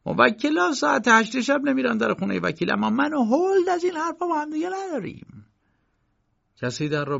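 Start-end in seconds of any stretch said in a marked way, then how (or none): 0.0-5.5 high-pitched uncle figure, protesting; much higher voice for the uncle; عموی کی. A narrator's reading. (عموی معترض با صدای زیر)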